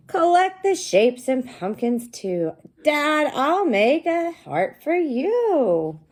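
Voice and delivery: high, loud, slow storybook read-aloud; sing-song read-aloud for young kids; reading to children. Acted. Teacher reading the book aloud to her class, slow and bright.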